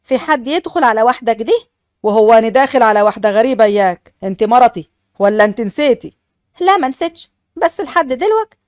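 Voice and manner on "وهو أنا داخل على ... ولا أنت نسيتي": a lower, firmer man's voice for the husband; husband's voice. Acted. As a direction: speak as the stern new husband. Narrator voices Fares the husband. (صوت الزوج الحازم بنبرة أخفض)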